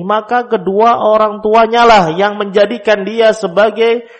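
preaching at full force. Acted. Preacher proclaims to the congregation that parents shape the child, loud and high.

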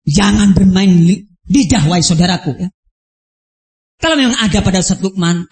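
ordinary delivery

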